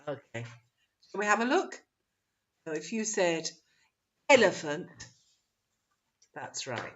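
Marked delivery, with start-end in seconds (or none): 4.3-4.9 "elephant" with a loud "EL"; first-syllable stress. Shown as a demonstration. Teacher giving the answer, stress on the first syllable. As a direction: punch "el", let "ephant" fall off. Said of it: "Which syllable is stressed".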